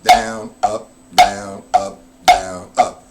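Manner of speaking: counting strokes with accented "down"; stress on down. Shown as a demonstration. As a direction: say "down" hard, "up" light. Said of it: "that's the downstroke".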